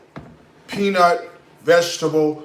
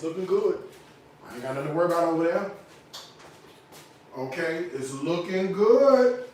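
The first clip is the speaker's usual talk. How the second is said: ordinary talk, nothing marked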